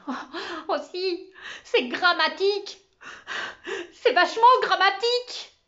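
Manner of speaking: high, loud sobbing stammer; little boy crying and stammering in class. Acted. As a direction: blubber it out through tears. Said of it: "se mit à pleurer. Il balbutiait".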